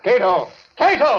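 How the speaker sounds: calling out. Acted. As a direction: shout the servant's name across the house. Doctor calls his servant urgently.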